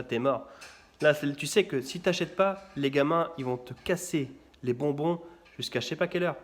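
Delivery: normal talking voice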